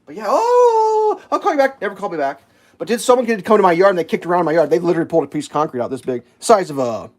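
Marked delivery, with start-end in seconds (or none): 0.3-1.8 very high, hammed-up wail; mock crying. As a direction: whine it like a fake sob. Mocking panicked official again.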